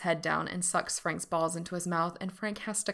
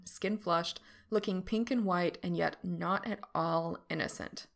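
usual speaking voice

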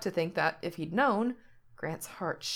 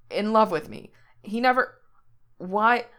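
shocked disbelief; 3 semitones higher, 6 dB louder than usual. Acted.

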